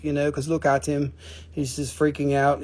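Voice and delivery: ordinary talk, nothing marked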